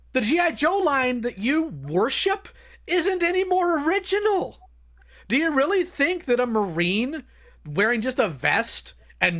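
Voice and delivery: high, mocking disbelief; sarcastic rant. Real, not acted.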